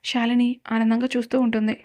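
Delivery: usual speaking voice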